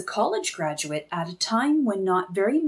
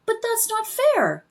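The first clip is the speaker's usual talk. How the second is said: put-on indignant child; 12 semitones higher than usual. Child protests unfairness.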